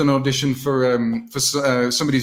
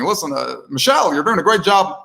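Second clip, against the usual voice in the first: higher-pitched presidential impersonation; Barack Obama; 4 semitones higher than usual. Acted. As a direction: praise her in an impersonated president's voice. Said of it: "do a message as Barack Obama".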